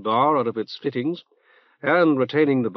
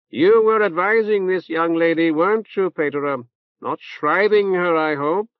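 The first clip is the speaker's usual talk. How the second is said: reverberant nasal voice; 6 semitones higher, 5 dB louder than usual. Acted. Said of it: "in a reverberant nasal voice".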